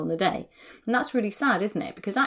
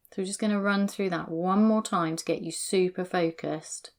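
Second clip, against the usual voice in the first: usual speaking voice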